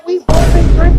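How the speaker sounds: loud. Real, not acted. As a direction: cry it out loud to the officer. Husband pleads at arrest.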